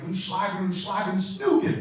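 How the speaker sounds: friend's terrible fake German Hitler yelling. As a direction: ham up a gibberish German Hitler rant. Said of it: "He's yelly. I could do that".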